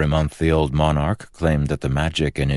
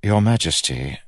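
raised pitch, held smooth; controlled politeness; 7 semitones higher than usual. A narrator's reading. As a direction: address a king with careful restraint. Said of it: "trying to keep his voice smooth".

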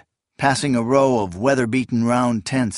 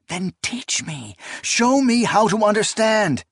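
urgent plea; 10 semitones higher than usual. Acted. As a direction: plead insistently, rising in pitch and force. Acted quarrel, she pleads.

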